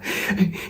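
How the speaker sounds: a quick soft burst of laughter; short amused laugh; short real laugh. Real, not acted. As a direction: laugh briefly at the absurdity. Laughs at the guy's claim.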